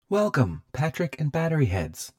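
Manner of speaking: high techno voice. A narrator's reading. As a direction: announce it as a high talking house. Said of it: "the voice said again".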